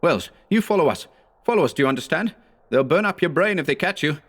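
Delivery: urgent cry. A narrator's reading. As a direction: urge him on, hard and fast. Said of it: "cried Howard".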